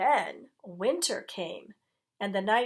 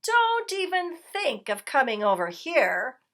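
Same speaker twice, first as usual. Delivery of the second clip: rat warning; 6 semitones higher, 5 dB louder than usual. A narrator's reading. Rat warns dog.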